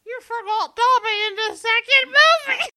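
wailing, tearful yell; crying shout. Acted. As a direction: shout it through tears. Upset outburst at forgetting Dobby.

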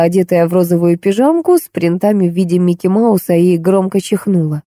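plain reading voice

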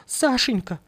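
high calling cry to the dead husband; high plaintive call. Acted. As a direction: call out his name, pleading. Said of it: "закричала она".

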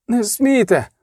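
high, lightly acted pained plea; moaned protest. A narrator's reading. Beaten lover pleading for the woman, moaned protest. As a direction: groan out a protest, understated. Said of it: "простогнав Рейневан".

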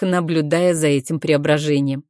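usual speaking voice

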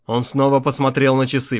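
ordinary delivery

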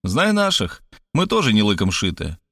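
ordinary delivery

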